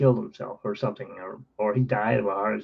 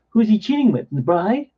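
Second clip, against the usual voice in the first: put-on louder and higher, a kid's scoffing protest; kid's indignant outburst; 8 semitones higher, 6 dB louder than usual. Kid protesting to his mom, loud and scoffing. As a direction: scoff at the idea like an outraged kid.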